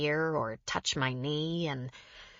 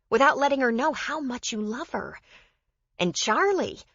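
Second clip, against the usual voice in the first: higher-pitched woman earnestly urging her companion; Joe, pleading; 6 semitones higher, 3 dB louder than usual. Acted. Narrator voices Joe in dialogue.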